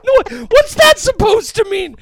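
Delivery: high-pitched mock-offended protest; mock outrage. Man teasing co-host over a supposed fat joke, mock outraged. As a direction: play indignant, voice shooting way up.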